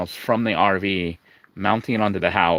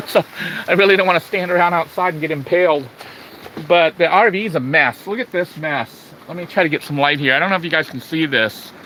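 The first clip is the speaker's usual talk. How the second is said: raised voice over the wind; shouting over wind; 6 semitones higher than usual. Real, not acted. Outside in strong wind.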